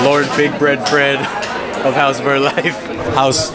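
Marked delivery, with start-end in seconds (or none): none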